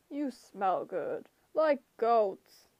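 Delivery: slow, hungry giant voice; monster voice. A narrator's reading. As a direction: savor it like a brute. Cyclops voice.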